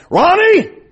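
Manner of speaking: high, loud call of a name; hollered name. Retold or imitated. Neighbor lady hollering at a boy from her porch, loud call. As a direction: holler it across the yard. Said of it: "she hollered at me".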